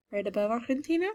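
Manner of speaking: normal talking voice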